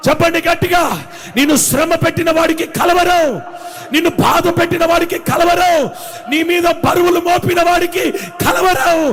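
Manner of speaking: loud, high fervent preaching cry; preaching at full force. Acted. Preacher to his congregation, shouting with full fervour. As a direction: rouse the congregation with rising shouts.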